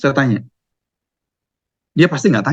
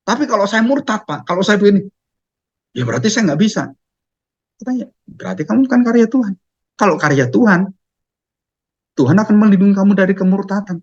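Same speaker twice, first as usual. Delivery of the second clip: ordinary delivery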